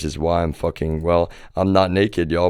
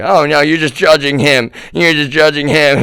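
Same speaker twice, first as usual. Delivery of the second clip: much higher, louder whiny protest; mock defensive relatives; 9 semitones higher, 7 dB louder than usual. Relatives defending Trump against the cokehead claim, mock whiny protest. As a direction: parody them accusing him, higher and louder. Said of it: "and it's like".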